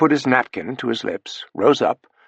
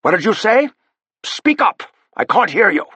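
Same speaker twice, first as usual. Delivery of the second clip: calling down the phone; 10 semitones higher, 4 dB louder than usual. Acted. Straining to hear on phone.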